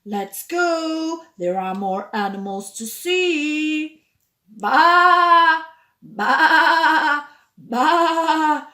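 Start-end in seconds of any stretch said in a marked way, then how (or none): 4.5-8.7 loud bleating baa; sheep bleat. Shown as a demonstration. Imitating sheep for children, loud bleats.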